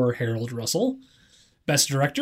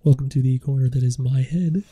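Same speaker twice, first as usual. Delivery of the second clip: right up on the microphone; close-mic voice; 4 dB louder than usual. Shown as a demonstration. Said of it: "that's a little creepy".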